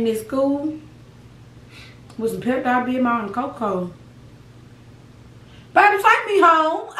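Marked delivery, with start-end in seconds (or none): 5.7-6.9 high pleading whine; childish whine. Retold or imitated. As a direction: beg like a sick kid. Retelling begging her dad at school.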